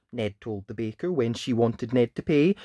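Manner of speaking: put-on lively read-aloud voice for children; animated picture-book narration; storybook reading. A narrator's reading. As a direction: tell it like bedtime story time.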